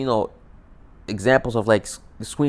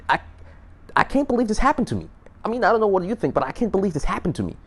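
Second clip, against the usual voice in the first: high incredulous lament; 9 semitones higher than usual. Real, not acted. Lamenting the lost chance.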